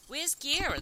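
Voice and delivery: loud, high shout for someone. A narrator's reading. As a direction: holler across the room for a colleague. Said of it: "the guy shouted".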